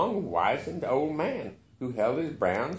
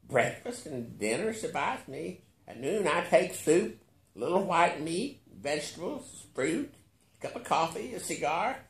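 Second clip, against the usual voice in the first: lightly voiced old billionaire, higher; 7 semitones higher than usual. A narrator's reading. Billionaire calmly listing his meals to narrator, higher. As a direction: list his meals peacefully. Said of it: "he answered peacefully".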